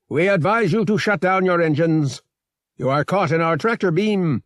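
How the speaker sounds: high, forceful captain's announcement; commanding comm voice. A narrator's reading. Corvette captain hailing the Talisman, commanding. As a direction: issue orders as a captain over comms. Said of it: "a voice erupted from the comm unit".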